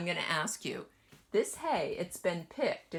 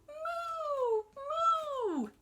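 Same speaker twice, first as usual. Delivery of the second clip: very high playful mooing; cow moo; 3 dB louder than usual. Acted. Teacher mooing for the children, high and playful. Cow sound read aloud.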